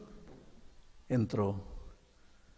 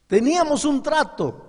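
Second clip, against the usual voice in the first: high, loud insistence; stern mother; 13 semitones higher, 6 dB louder than usual. Acted. Mother reminding her son of the deal, high and firm. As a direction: insist firmly.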